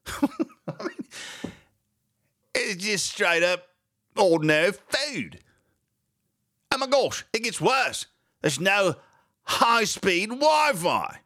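high, prissy mock-British indignation; hammed-up British accent, high and fussy; put-on British accent. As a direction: play an outraged Brit reading the news. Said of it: "I could talk like this the rest of the program".

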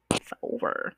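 "It's over" whispered with no voice. Shown as a demonstration. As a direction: whisper "It's over" under your breath. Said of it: "the relationship is over".

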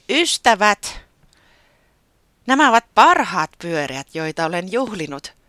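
high, loud little bird voice making a toast; Taputi speech. A narrator's reading. Taputi toasting the party guests, high and loud. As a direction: proudly address the guests as the small host.

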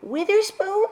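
shocked squeak. Acted. Shocked guess at the name.

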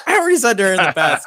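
high, loud laughing aside. Real, not acted. Telling a friend while laughing, high and loud. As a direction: laugh through the line.

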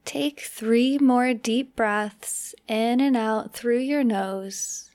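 unhurried meditation guide with long pauses; measured, spacious delivery for relaxation; guided meditation. Acted. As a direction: ease the listener slowly into relaxing.

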